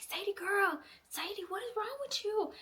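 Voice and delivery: very high, incredulous scolding; exasperated pleading. Acted. Exasperated appeal to book's heroine.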